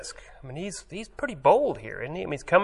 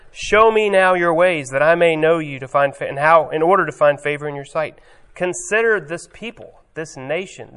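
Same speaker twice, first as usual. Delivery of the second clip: loud, higher, insistent protest; not having it; 4 semitones higher, 6 dB louder than usual. Retold or imitated. Moses refuses to accept God's word, loud insistent protest. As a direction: press God hard, won't back down. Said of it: "Moses is not having it".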